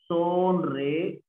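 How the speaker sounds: "Sonreír" as son, re... ír; stretched, separated syllables in "Sonreír". Shown as a demonstration. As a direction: sound out "Sonreír" slowly, pausing before ír. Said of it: "Cómo sería sonreír".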